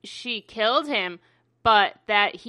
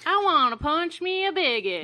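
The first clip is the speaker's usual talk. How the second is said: mock Southern US accent; 5 semitones higher than usual. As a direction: say it like a feisty Southern gal. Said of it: "only in Southern".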